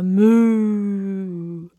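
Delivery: cow moo. Acted. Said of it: "I am a cow".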